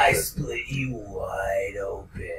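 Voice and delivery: slow, raspy threatening whisper; menacing wrestler whisper. Retold or imitated. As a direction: hiss the threat slowly. Acted wrestler promo threat.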